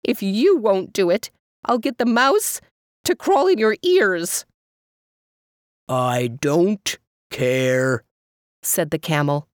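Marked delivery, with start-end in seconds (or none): 0.0-4.4 sharp retort. A narrator's reading. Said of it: "Mother shot back". 5.9-8.0 very slow, low drawl; deep camel voice. Acted. Camel refuses.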